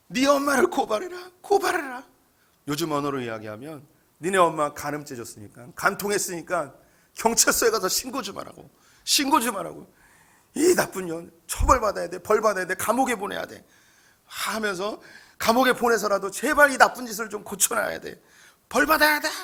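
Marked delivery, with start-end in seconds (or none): 0.1-2.1 mock wailing, much higher and louder; wailing cry. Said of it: "울부짖는 거예요". 4.2-19.3 rising into a loud higher shout; angry shouting. Retold or imitated. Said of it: "막 소리를 지르고 있는 거예요".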